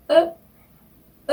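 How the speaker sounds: 으 high with lips rounded; rounded French "eu"; French eu vowel. Shown as a demonstration. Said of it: "이런 발음이 됩니다".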